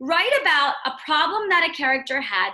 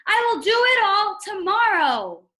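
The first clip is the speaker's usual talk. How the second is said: higher and louder, exasperated; crying out; 7 semitones higher, 6 dB louder than usual. A narrator's reading. Toad crying out to Frog, exasperated and loud. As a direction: shout it out, fed up. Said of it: "cried Toad".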